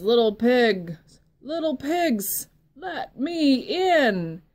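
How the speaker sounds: loud, slow big bad wolf calling. Wolf calling loudly to the pig at the door. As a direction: call out like the hungry wolf at the door.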